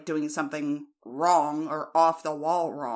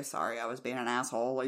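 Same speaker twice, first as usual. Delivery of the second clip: lower, softer, quick sheepish apology; 5 semitones lower, 6 dB quieter, faster than usual. Retold or imitated. American explaining how she'd apologise, soft and quick. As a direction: mumble the sorry quickly, a bit embarrassed. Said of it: "I would just say".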